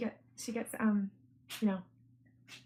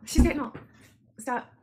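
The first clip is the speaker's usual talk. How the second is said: normal talking voice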